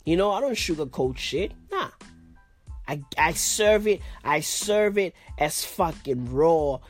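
ordinary talk, nothing marked

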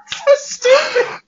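slow words squeezed through high laughter; laughing. Real, not acted. Friend cracking up retelling a movie scene, laughing.

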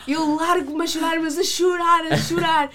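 exclaiming how hard she cried; loud, emphatic retelling. Real, not acted. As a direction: stretch out the crying part, loudly.

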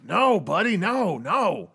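high, loud wailing whoas; alarmed pleading. Acted. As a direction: talk him down in a panic, easing off. Marky grabbed and threatened.

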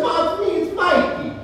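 high, imitated Italian-American son; East Coast Italian accent. Son insisting to his mother, imitated high Italian accent. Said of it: "he had that Eastern Italian accent".

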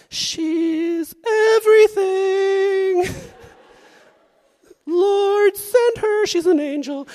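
high, dreamy gushing over a crush; swooning lovestruck teen. Pretend. Lovestruck teen gushes about crush, high and dreamy. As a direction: sigh it out like a smitten kid.

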